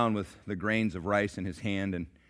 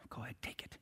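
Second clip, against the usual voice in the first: grudging whisper; 2 semitones higher, 15 dB quieter, faster than usual. Acted. Grudging beggar voice.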